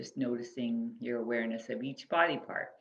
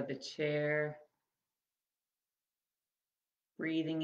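measured, spacious phrases that leave room to breathe; guided body scan meditation. Acted. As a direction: guide them gently through the body, unhurried.

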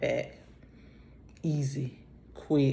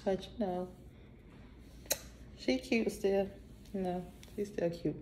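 murmured to herself, much softer; quiet self-compliment; soft aside; 2 semitones higher, 11 dB quieter than usual. Real, not acted. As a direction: reassure herself under her breath.

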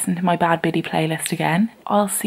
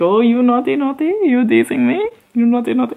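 teasing viewers; 4 semitones higher, 5 dB louder than usual. Retold or imitated. Voices viewers' teasing reply.

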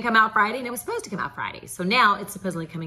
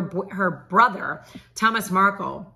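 normal talking voice

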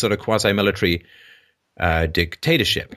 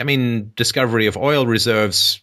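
ordinary talk, nothing marked

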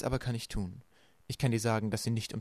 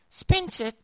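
put-on high, loud little-child squeal; hoarse from screaming; 7 dB louder than usual. A narrator's reading. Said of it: "völlig heiser war vom Schreien". (heiser geschrien, hoch und laut)

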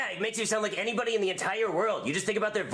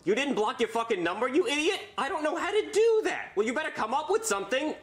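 panicked yelling; 7 semitones higher than usual. Real, not acted. Panicked argument mid-prank call.

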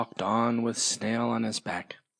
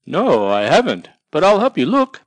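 louder, higher cheerful bug; Ladybird helping; 5 semitones higher, 9 dB louder than usual. Acted. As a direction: offer help gladly. Ladybird offers help.

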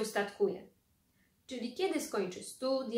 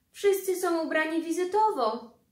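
higher, louder complaint; tut-tut at his outfit; indignant aunt; 7 semitones higher, 6 dB louder than usual. Acted. Druga ciotka łaje wujka. (ciotka z oburzeniem wytyka strój)

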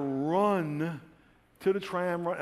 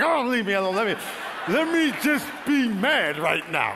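loud, high irritated outburst, slowing at the end; retold mad shout, drawn out on the last plea; hammed-up anger; 6 semitones higher, 5 dB louder than usual. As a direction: snap loudly at your comforting wife. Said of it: "I get so mad".

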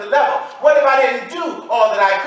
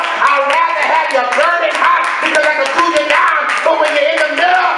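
loud, high preaching over the organ; full-force preaching; 5 semitones higher, 5 dB louder than usual. Real, not acted. Preacher crying out God's words to lukewarm believers in full preaching voice. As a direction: build it up and hammer the message home.

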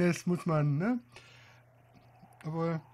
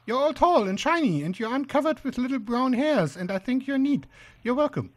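higher, louder little spider; high-pitched inspection spider; 6 semitones higher, 3 dB louder than usual. Acted. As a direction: pay compliments in a high spider voice.

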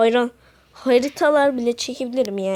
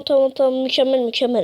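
ordinary talk, nothing marked

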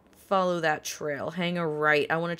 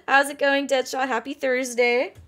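bright greeting; 6 semitones higher, 5 dB louder than usual. Real, not acted. Cheerful greeting to viewer.

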